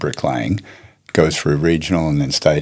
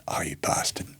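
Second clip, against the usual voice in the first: whispered realization; 11 dB quieter than usual. Retold or imitated. Penny drops, she realizes.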